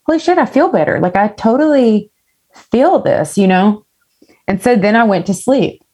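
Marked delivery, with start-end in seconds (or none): 0.0-3.8 amazed relief. Retold or imitated. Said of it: "I was like".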